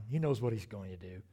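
ordinary talk

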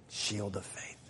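hushed echo. Shown as a demonstration. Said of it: "echoed softer".

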